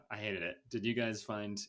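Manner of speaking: normal talking voice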